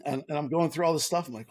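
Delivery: usual speaking voice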